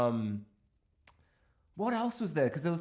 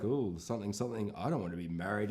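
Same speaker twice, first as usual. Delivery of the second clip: lower, softer mock jabs; snide digs; 5 semitones lower, 7 dB quieter than usual. Husband making snide digs at his partner, low and soft. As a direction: toss off the jibes under your breath. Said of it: "he keeps doing digs like".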